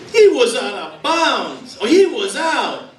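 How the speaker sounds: high, drawn-out mock yell; yelling at screen. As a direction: heckle the TV like a New York sports fan. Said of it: "yells at the screen as if they could hear him".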